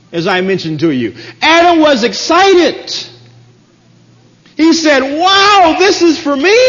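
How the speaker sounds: fervent sermon climax; rising preaching. Acted. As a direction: build to a triumphant pulpit cry. Preaching at full force.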